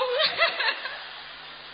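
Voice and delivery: a high laugh that breaks near tears; tearful laugh. Real, not acted. Laughing through tears while telling the priest. Laughs after retelling plea.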